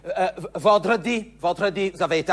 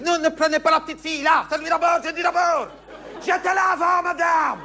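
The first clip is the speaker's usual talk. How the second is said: higher, raised indignant voice; indignant protest; 6 semitones higher than usual. Acted. Mother defending her child's place in the Saint-Nicolas queue, indignant. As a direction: stake your place in line.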